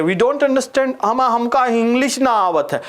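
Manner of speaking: high, whiny mock student complaint; a high mock whine, no English please. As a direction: put on a high bumpkin student voice whining about English. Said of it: "कुछ कहते हैं".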